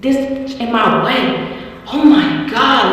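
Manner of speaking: slow drawled road rage quote; slow imitated friend. Imitating the friend driving, slow drawled complaint. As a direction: drag it out like the friend. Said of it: "She was like".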